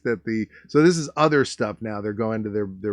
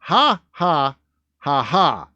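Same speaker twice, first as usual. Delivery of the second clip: mock laugh; 2 semitones higher, 5 dB louder, slower than usual. Fake laugh after joke.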